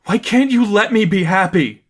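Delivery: high and tense, held back in volume; burning but reined in; intense plea. A narrator's reading. Stephanie pleading with Cassandra, intense and restrained. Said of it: "reined in the volume, same intensity".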